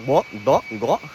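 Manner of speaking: implosive "Glug, glug, glug" gulped inward; glugging sound. Shown as a demonstration. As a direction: gulp each "glug" like Goofy. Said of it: "apply that to all sounds".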